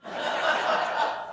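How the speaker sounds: a quiet breathy chuckle; a brief unvoiced laugh at himself; soft laugh. Real, not acted. Pastor laughing at his own joke, soft chuckle. Joke about sermon length.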